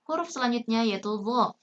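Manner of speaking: usual speaking voice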